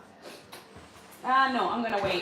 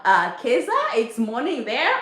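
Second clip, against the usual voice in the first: usual speaking voice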